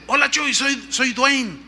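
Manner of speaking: high-pitched, mock-ironic caller. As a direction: imitate a pastor's pointed irony on the phone. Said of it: "en tono irónico me dice".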